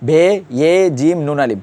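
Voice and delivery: slow বে ইয়ে জিম নুন আলিফ, he merged into the first letter; বে ইয়ে জিম নুন আলিফ, letter by letter without he; correct spelling-out. Shown as a demonstration. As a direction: spell it slowly as bhe, ye, jim, nun, alif. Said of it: "কীভাবে বলবেন?".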